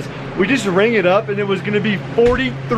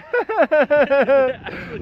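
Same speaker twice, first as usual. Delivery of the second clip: pained laugh; 8 semitones higher than usual. Real, not acted. Laughing with tongue stuck.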